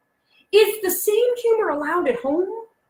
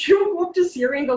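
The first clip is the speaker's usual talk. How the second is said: normal talking voice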